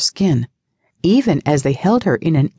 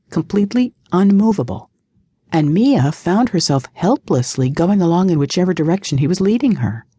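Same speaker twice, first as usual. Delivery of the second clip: usual speaking voice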